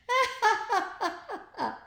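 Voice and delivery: put-on high gleeful boy's laugh; boyish laugh. A narrator's reading. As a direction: cackle with glee at the upside-down head. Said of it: "Ned laughed".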